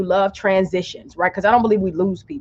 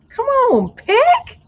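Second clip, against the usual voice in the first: squealing plea; slower than usual. Real, not acted. Urging the card pick.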